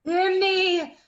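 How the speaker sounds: loud, high cry for attention; furious call to be heard; commanding shout. Acted. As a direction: seize the whole room with it. Margaret curses the quarrelling court.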